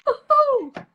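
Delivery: high, loud woohoo; celebratory whoop. Retold or imitated. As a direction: re-enact a triumphant victory whoop. Acts out joy at fitting jeans.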